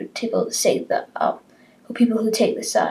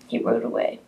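ordinary delivery

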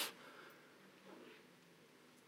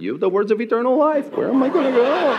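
put-on high-pitched comic Yiddish accent; 13 semitones higher than usual. Peter answering Jesus, in a mock Yiddish accent. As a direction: plead it in a hammed-up Yiddish accent. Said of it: "more of a Yiddish accent".

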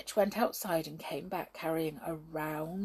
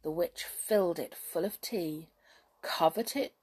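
usual speaking voice

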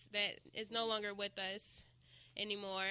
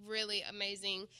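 ordinary delivery

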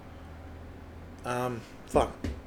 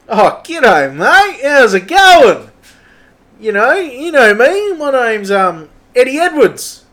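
Aussie tradie; 6 semitones higher, 4 dB louder than usual. Acted. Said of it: "what's a typical tradie name".

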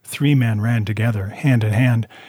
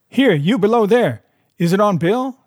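high, demanding hail; crying out; 9 semitones higher than usual. A narrator's reading. Blind man calls to those below there, crying out. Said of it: "cried the blind man again".

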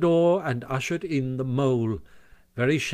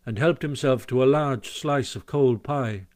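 ordinary delivery, nothing marked

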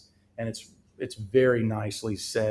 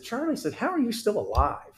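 teasing jab; 9 semitones higher than usual. Retold or imitated. Teasing a sick friend over beers.

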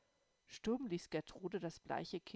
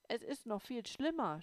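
sobbing; 5 semitones higher, 4 dB louder than usual. A narrator's reading. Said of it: "schluchzte sie".

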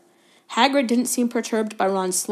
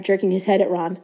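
plain reading voice

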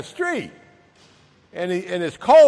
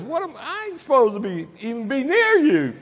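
high-pitched acted disbelief, easing down; mock-alarmed refusal in a raised voice; incredulous addict; 6 semitones higher than usual. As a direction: balk at a talking snake, indignant. Addict balks at talking snake.

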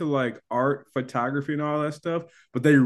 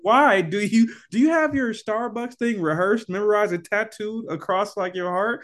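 exasperated mock retort; 6 semitones higher than usual. Acted retort to coffee snob.

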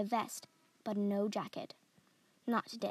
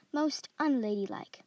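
usual speaking voice

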